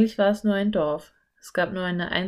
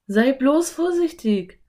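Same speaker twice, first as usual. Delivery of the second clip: warning; 6 semitones higher, 4 dB louder than usual. A narrator's reading. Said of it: "ermahnte Philipp seine Schwester".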